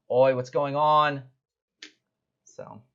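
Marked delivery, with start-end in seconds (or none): 0.1-1.2 loud, slow "Oi" of a street guard; a public guard barks into the alley; guard's call. Acted. Guard shouting into the alley, slow and loud. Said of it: "just goes".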